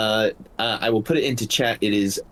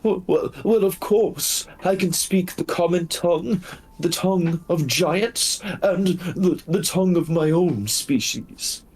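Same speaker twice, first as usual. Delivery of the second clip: high, quick, whimpering wolf voice; a frightened injured wolf pleading; timid beast in a high voice; pitiful wolf; 8 semitones higher than usual. Acted.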